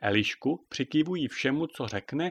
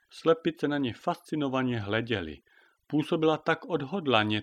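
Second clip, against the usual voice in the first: plain reading voice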